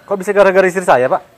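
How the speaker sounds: high, loud protest in disbelief; husband shouting at the shopkeeper for blaming his wife; indignant shout. Acted. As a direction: shout back in outrage.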